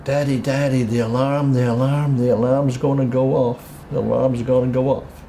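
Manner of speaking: urgent child's chant, lightly imitated; little daughter. Daughter waking Daddy before the alarm goes, lightly imitated child. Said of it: "my little girl Debbie".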